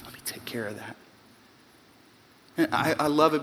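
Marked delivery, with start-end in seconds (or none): 0.0-1.0 very soft, low voice of a caring God; almost under the breath, low and tender; tender hush. Acted. God comes down to his creation to comfort, tender hush.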